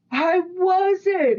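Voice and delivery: high, whiny denial; defensive partner. Retold or imitated. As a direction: deny it in a high whine. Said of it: "He goes".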